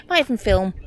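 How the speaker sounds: normal talking voice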